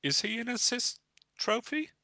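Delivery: ordinary talk, nothing marked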